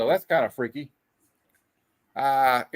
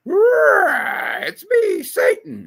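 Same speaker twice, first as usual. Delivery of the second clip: much higher, louder hammed-up shout; mock villain shout; 8 dB louder, much slower than usual. Reading Satan's grand entrance, hammed-up shout. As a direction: bellow it like a cartoon devil announcing himself.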